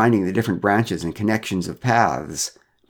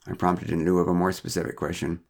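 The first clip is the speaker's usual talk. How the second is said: ordinary delivery, nothing marked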